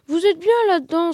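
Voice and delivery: storybook sobbing complaint; sobbing bear. A narrator's reading. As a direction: wail it like a left-out bear. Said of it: "sanglotait-il".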